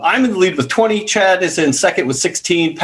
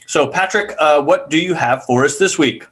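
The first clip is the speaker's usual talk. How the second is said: ordinary talk, nothing marked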